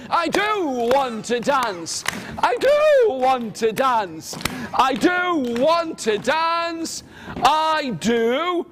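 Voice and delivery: high, slow, swooping chant; drawn-out declaration building to a held final word; insistent refrain. Acted. Leading children in Lily's refrain.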